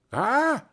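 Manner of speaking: a sharp high "Ah!" bursting into laughter; the woman cackles, amused; cackling laugh. A narrator's reading. The charwoman, amused by Joe's question, cackling. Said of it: "laughing and leaning forward".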